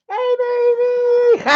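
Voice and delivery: stretched-out sing-song doggy talk. Real, not acted. Cooing at his dog Penny.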